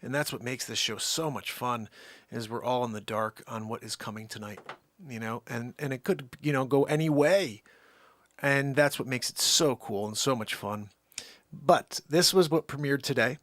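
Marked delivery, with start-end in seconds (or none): none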